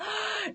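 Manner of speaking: a sharp high gasp of delight; high gasp at a viewer's hometown; excited gasp. Real, not acted. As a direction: gasp with surprised joy. Reacting to viewer locations.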